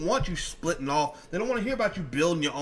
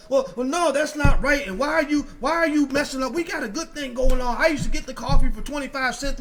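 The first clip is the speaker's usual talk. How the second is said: high, loud, aggrieved whine; hammed-up outrage over the price hike; mock indignant complaint; 7 semitones higher, 6 dB louder than usual. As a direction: play the cheated customer, outraged. Acted quarrel over coffee price.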